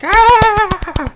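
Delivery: loud, very high mock cackle; exaggerated high-pitched hysterics; imitated audience laughter. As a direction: shriek out a fake crowd laugh. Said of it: "they'll clap and they'll laugh".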